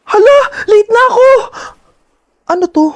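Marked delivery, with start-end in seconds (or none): 0.0-1.3 high, alarmed cry on waking late; panicked. Acted. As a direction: blurt it out in a panic. Wakes up late for class.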